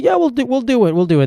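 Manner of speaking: high, breezy promise that means nothing; phone rep brush-off. Retold or imitated. Mocking the rep who promises to fix his bill, high and breezy. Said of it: "And they say".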